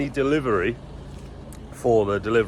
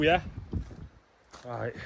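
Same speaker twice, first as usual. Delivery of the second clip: ordinary delivery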